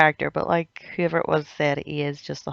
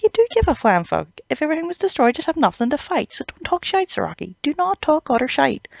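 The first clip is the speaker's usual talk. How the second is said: much higher, sharp telling-off voice; mock scolding captain; 10 semitones higher than usual. Hitsugaya scolding Zaraki for talking nonsense, much higher. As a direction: scold him like an exasperated captain. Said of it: "He's like".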